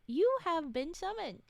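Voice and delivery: normal talking voice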